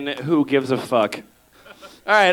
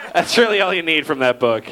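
ordinary talk

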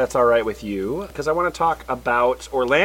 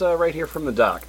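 normal talking voice

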